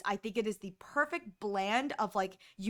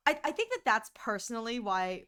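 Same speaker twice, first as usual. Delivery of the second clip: ordinary talk, nothing marked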